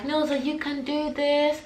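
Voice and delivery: high, slow encouraging mum. Retold or imitated. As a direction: cheer her on gently. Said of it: "Mum was like".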